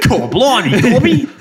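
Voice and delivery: high, loud mock Aussie cry; over-the-top Australian. Podcaster mocking the voiceover for a friend, exaggerated Australian. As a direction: yell it in a hammed-up Aussie accent, half laughing. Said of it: "This is how you do an Australian accent".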